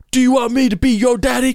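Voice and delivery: loud, high barking drill sergeant; drill sergeant yell. Retold or imitated. Drill sergeant yelling at a misbehaving kid. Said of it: "the guy's like".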